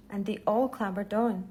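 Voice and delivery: ordinary delivery, nothing marked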